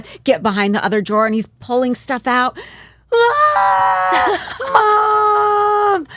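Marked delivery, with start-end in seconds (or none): 3.1-6.1 put-on high, loud laughing kid yell; kid screaming. Son yells for mom at the second find, high and loud. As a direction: shriek for mom.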